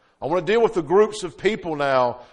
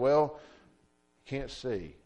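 sad parents; 5 semitones lower, 8 dB quieter than usual. Retold or imitated. Parents voiced grieving.

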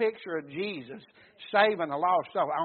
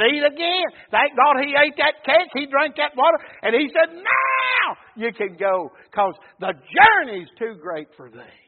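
loud, raised, with a long held cry; fervent preaching; 6 semitones higher, 8 dB louder, slower than usual. Real, not acted. Preacher retells the angel feeding Elijah, fervent shouted preaching. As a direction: preach hard and stretch the key word.

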